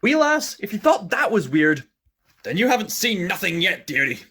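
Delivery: high Irish-style leprechaun voice; cackling old Celtic imp. Acted. Scottish-ish old voice, wee lass dearie.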